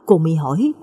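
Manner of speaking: ordinary delivery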